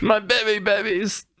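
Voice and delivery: high put-on pained voice; mock pained quote. Retelling the kick scene, high mock pained squeak. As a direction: squeak it like a hurt character. Quoting Barry's groan.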